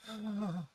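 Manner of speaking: acted sigh. Her letting the small one down, acted sigh. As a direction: let out an audible sigh for the character. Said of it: "you hear that sigh".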